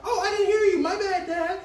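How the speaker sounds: put-on high, teasing girl voice with a laugh; mock daughter. Imitating the daughter shrugging off her dad, high and giggly. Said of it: "and then she's sitting on her motherfucking bed".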